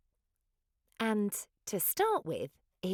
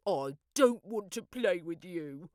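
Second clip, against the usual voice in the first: grumpy saurus. A narrator's reading. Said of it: "a grumpy saurus cried".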